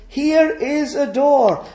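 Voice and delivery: high, slow proclaiming call. Acted. Voice on the verge showing the door, calling out. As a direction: announce it slowly to a far-off crowd. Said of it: "this voice calls out".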